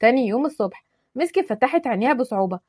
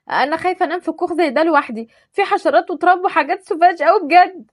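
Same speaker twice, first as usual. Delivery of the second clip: higher, pampered complaint; spoiled whining; 6 semitones higher, 3 dB louder than usual. Acted. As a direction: whine like a rich girl.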